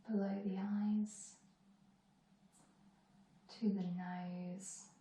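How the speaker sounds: calm body-scan guidance with long pauses; guided meditation. Acted. Teacher leading students through a moving body scan, calm meditation voice. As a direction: guide them unhurriedly from one body part to the next.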